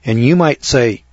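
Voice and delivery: ordinary talk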